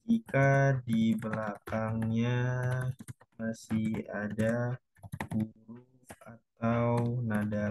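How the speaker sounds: slow, word-by-word dictation pace. Shown as a demonstration. Teacher dictates the note for the class, slowly. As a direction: say each word slowly so students can write it down. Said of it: "dictating a note slowly".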